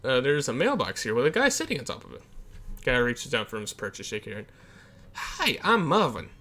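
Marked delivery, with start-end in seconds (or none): 5.1-6.3 lightly voiced Marvin. Marvin introduces himself.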